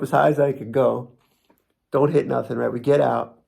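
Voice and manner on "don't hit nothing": ordinary talk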